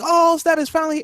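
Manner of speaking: high-pitched mock crowd excitement; excited crowd. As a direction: imitate fans losing it at a reveal. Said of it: "Everybody just started going crazy".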